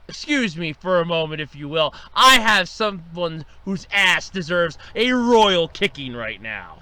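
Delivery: usual speaking voice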